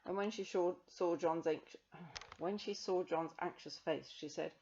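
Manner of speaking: plain reading voice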